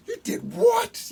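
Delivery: high loud outburst of disbelief; shocked yell. Acted. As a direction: shout it in horror. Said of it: "yells Coyote".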